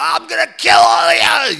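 loud high threatening yell; drunken threat. Retold or imitated. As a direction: scream the threat at the whole family. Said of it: "or so".

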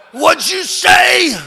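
put-on loud, high, slow drunken shout; drunken yell. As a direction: bellow it like a drunk with a gun. Drunk threatening family at Christmas.

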